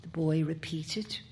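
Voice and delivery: ordinary delivery, nothing marked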